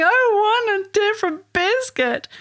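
high-pitched mock child's whine; whining child. Child demanding another biscuit, whining in a high voice. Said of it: "So if they're saying".

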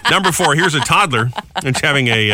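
ordinary talk, nothing marked

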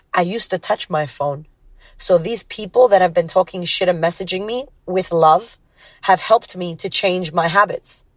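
ordinary talk

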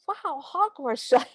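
high, drawn-out wow at an absurd name; mock amazement. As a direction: play exaggerated, slow wonder. Mock reaction to invented place name.

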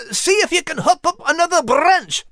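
high, lively urging; prodding. A narrator's reading. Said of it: "prodded Max".

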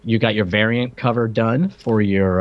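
ordinary talk, nothing marked

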